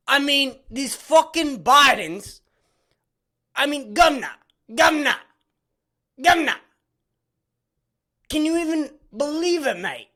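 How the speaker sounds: high, loud hammed-up Aussie rant; cartoon Australian accent, yelling governor; mock Australian accent. Continues mocking Australian news voice.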